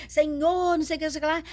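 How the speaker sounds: hammed-up imitation of a flashy TV preacher; Marco Feliciano impression. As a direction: mimic a showy preacher's wail. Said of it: "falava igualzinho ele".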